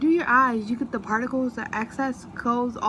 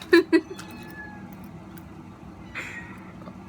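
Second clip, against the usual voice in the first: long breathy giggle fit; giggling helplessly; laughing; 7 semitones higher than usual. Real, not acted. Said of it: "I can't stop laughing".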